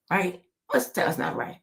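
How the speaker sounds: ordinary talk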